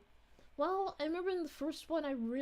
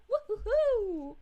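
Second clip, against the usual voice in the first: cheering whoop; 7 semitones higher, 8 dB louder than usual. Real, not acted. Cheering a viewer's arrival.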